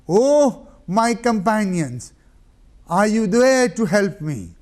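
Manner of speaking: loud, high cry into emptiness; anguished call. Acted. Hussein crying out for his fallen companions. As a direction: call out to the field.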